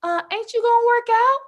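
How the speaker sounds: put-on high, loud child voice; little niece.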